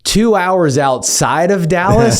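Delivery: high, teasing disbelief; mock surprise. Lawyer teasing opposing counsel about his small town, mock surprise. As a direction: needle him about his tiny hometown.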